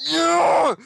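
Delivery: high, loud reenacted cheer; retold shout. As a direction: shout it like a fan erupting. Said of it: "like, just shouting".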